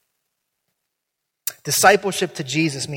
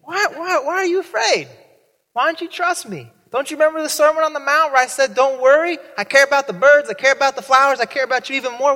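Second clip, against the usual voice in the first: much higher, fast fussy teacher voice; mock Jesus lecturing; 8 semitones higher, 5 dB louder, faster than usual. Jesus lecturing his panicked disciples, high and hammed-up. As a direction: play him as a chattering lecturer.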